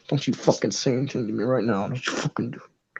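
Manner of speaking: low threat fading to a hiss of a whisper; menacing whisper. Acted. Angry tiefling warning the chatty player to stay silent, low menacing whisper. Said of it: "He's looking at you like".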